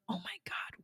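breathless whisper of disbelief; shocked whisper. Retold or imitated. Influencer flooded with hate mail, shocked whisper. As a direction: gasp it out under your breath.

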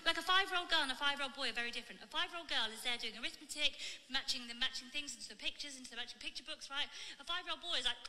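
loud punchy comedy set; stand-up delivery. Acted. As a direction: deliver it to a crowd. Playing her stand-up clip.

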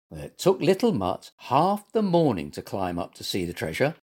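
usual speaking voice

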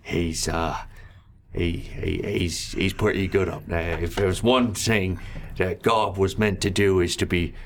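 lightly voiced goblin; a hint of a goblin showman; gurgling alien. GM plays goblin character.

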